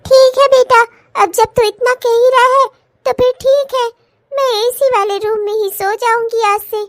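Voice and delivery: a lightly voiced mother; mother's voice. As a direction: play the old mother giving in. S1 plays the mother.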